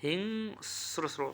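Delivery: হিংস্র stretched very slowly and softly, as hing-sro; careful pronunciation. Shown as a demonstration. Teacher repeating a word students forget, slow soft হিংস্র. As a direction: linger on হিংস্র so it sticks. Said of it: "ভুল করা যাবে না।".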